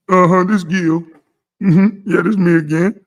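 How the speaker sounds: parodying Gil taking the call; mock Gil on phone. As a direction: answer like a goofy tough guy. Acts Gil answering goon call.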